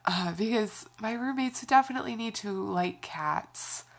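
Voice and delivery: ordinary talk, nothing marked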